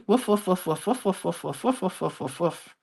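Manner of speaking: light playful dog barks, fading away; playful woof woof barking. Pretend.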